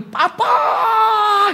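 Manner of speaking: very high, long drawn-out cry to dad; imitated kid's high-pitched holler; child's joyful shout. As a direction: squeal it like a boy running to his father. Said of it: "anak saya itu dari pintu luar sudah lihat papanya".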